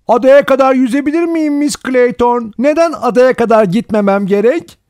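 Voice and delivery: put-on high, loud child's voice; whining child. Boy begging Miss Clayton to let him swim, high loud whine. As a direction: pester the governess in a piercing high voice. Remembered child pleading with governess.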